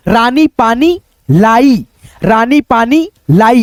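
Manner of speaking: रानी पानी लाई in one slow, smooth phrase, vowels drawn out; read together slowly. Shown as a demonstration. Teacher reading a full sentence to pupils, slowly. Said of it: "एक साथ हम combined करके पढ़ने".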